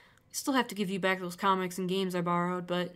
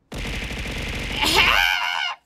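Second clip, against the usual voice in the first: startled laugh shout; 6 semitones higher, 8 dB louder than usual. Real, not acted. Jump scare while exploring.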